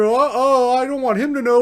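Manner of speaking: high-pitched mock protest; hammed-up embarrassed denial; imitating flustered girl.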